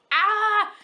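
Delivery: high, loud "ah"; exasperated cry. Real, not acted. Fan unable to explain her love, exasperated cry. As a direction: burst out, lost for words. Cannot explain why she loves them.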